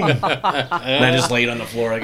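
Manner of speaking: ordinary talk, nothing marked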